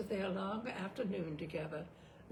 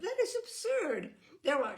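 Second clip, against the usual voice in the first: indignant protest; 12 semitones higher, 7 dB louder than usual. A narrator's reading. Eleanor protests.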